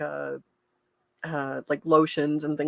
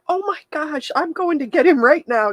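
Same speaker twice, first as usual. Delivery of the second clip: high, gushing urgency, louder at the end; sweetly moved nurse; 11 semitones higher, 6 dB louder than usual. Retold or imitated. Nurse promising to fetch a patient's dad, moved and urgent. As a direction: imitate a nurse rushing to help. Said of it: "She was so sweet".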